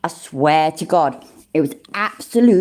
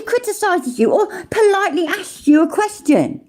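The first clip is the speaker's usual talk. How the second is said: much higher, jeering sing-song accusation; mocking outrage; 13 semitones higher than usual. Streamer mocking Chili's on-screen claim, high and jeering. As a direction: hurl it back at him in a scornful high voice.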